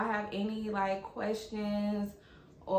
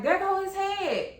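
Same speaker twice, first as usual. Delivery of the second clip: retold excited announcement; 11 semitones higher, 5 dB louder than usual. Nurses announcing the baby's head.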